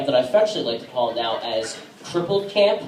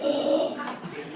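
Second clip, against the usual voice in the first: shocked gasp; 14 semitones higher, 7 dB quieter than usual. Acted.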